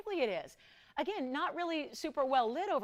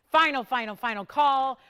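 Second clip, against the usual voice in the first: final call; 3 semitones higher, 9 dB louder than usual. Real, not acted. Host calls last chance.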